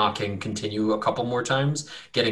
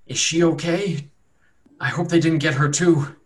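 higher, pained, anxious voice; worried captive; 6 semitones higher, 5 dB louder than usual. Acted. Wounded captive fears for the woman, anxious. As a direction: ask anxiously, voice lifted and louder.

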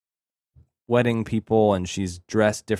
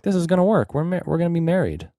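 pleading bride; 8 semitones higher, faster than usual. Retold or imitated. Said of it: "She's like".

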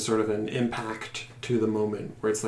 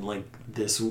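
normal talking voice

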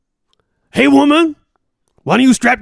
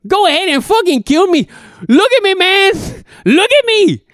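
anguished pleading; 8 semitones higher than usual. Acted. Legless Ramon to his escape mates, desperate begging. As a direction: beg them to end it, voice cracking.

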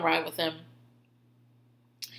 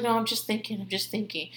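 dreamy mumble; 8 dB quieter than usual. Retold or imitated. Jen dreamily answering Victoria.

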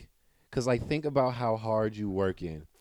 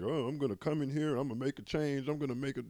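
high, lightly mocking go-getter voice; mock eager new hire; 6 semitones higher than usual. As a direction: parody someone eager to change things. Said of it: "people go in and like".

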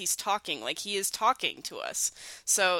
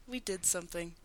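very soft, understated movie line; calm, low-key imitation; 3 semitones lower, 12 dB quieter than usual. Host imitating Phil's underwhelmed reaction to magic, calm. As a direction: say it softly, barely bothered. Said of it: "he says it so calmly".

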